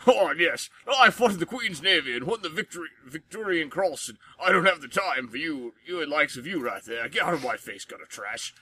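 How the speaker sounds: hammed-up blustering British general accent; grizzled British general. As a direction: bark it like a pompous British war veteran. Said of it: "I have been a fan forever of".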